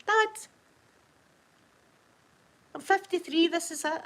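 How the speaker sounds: exasperated daughter, re-enacted; retold protest. Retells protesting to her dad.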